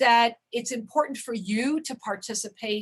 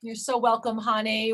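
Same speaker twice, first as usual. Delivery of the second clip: ordinary talk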